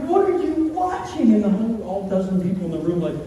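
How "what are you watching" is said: high mock-horrified disbelief; appalled question. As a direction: play aghast, pitch way up. Retold horrified question to the room.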